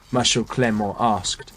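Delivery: ordinary delivery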